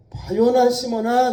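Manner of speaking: loud, high, slow call; Jesus calling Simon. Acted. Jesus calling out to Simon, slow and loud. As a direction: call him by name with weight. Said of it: "그러잖아요, 예수님".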